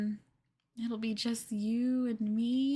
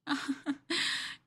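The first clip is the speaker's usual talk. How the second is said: giggle; 5 semitones higher than usual. Acted. Giggling at captive partner.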